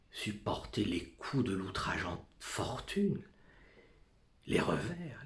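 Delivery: ordinary delivery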